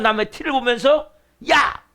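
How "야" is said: high, sudden mock yell; imitated angry shout. Someone scolding another over a small fault, mock shouting. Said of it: "막 소리치면서".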